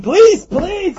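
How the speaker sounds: loud, high-pitched call; mock scream. Householder raising the alarm on a thief, mock scream. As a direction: yell for the police. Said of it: "And you can scream".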